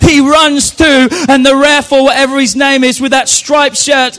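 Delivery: loud, rousing sermon shout; preaching at full force. Acted. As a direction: whip the congregation up at full volume.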